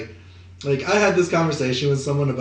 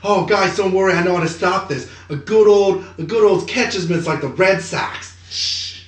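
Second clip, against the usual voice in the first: put-on Boston accent; 4 semitones higher than usual. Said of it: "He's like".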